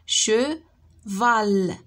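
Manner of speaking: "Cheval" split into che and val, both drawn out; syllable by syllable. Shown as a demonstration. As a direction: say "Cheval" in two long beats, che, val. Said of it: "Frappe les syllabes".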